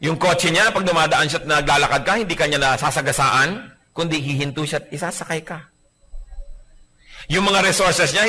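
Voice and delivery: ordinary delivery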